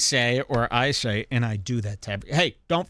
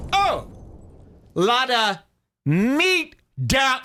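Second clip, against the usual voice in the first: much higher, shrill-free squeak of surprise; high-pitched cartoonish exclaim; squeaky mock exclaim; 9 semitones higher, slower than usual. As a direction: pop the voice way up in mock shock. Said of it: "And I'll say".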